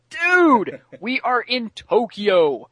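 high, loud, drawn-out yell. Retold or imitated. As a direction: shout it at him, slowly. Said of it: "And Cyborg yells at him".

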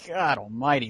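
ordinary talk, nothing marked

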